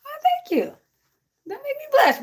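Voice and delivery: high, bashful thank-you; flattered squeal. Real, not acted. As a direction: gush like you're flattered. Flattered by chat compliment.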